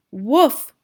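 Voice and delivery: a short loud woof, like a happy dog; dog bark. Acted. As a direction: bark it out like an eager puppy. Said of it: "barked Einstein".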